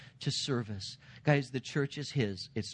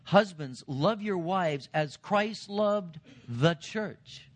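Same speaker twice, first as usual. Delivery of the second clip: slow, raised-pitch emphatic Bible reading; scripture reading; 6 semitones higher, much slower than usual. Acted. Preacher reads the charge to husbands, slow emphatic proclamation. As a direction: proclaim the verse slowly, pitched up.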